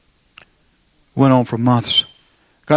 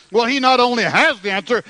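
high-pitched fervent sermon, building higher; impassioned preaching; 11 semitones higher than usual. Acted. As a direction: preach it with mounting fervor, pitched way up. Preaching builds to full force.

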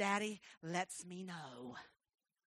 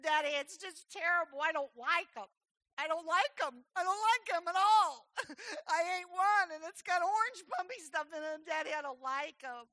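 high mock-crying little girl; tearful child; 8 semitones higher, 6 dB louder than usual. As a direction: whine the complaint to Daddy, half sobbing. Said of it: "in the middle of a puddle of tears".

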